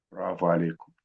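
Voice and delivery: usual speaking voice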